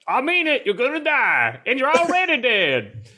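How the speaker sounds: high, insistent Southern warning; hammed-up Southern accent with a laugh breaking in near the end. Warning Goku he will die, in a Southern accent. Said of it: "Can you do a Southern accent?".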